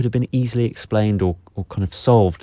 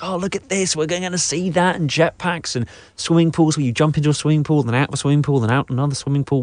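higher-pitched imitation of wowed viewers; mock excitement; 6 semitones higher than usual. Guest imitating audiences watching the trailers, mock wonder. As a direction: gush as if dazzled by a trailer. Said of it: "We're all like".

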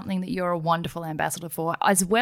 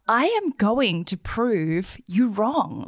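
slow and determined; much slower than usual. Acted. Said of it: "fueled your fire even more to be like".

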